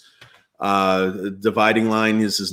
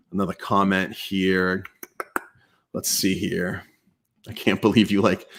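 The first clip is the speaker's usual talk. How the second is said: ordinary talk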